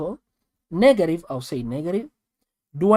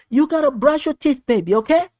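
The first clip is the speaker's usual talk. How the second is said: a higher, lightly voiced mother coaxing; sing-song a mama reminding her baby; mama's voice; 9 semitones higher, 5 dB louder than usual. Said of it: "your baby mama say".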